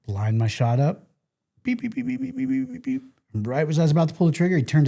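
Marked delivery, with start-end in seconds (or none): 1.6-3.0 imitated beeping. Imitating rangefinder beeps while aiming.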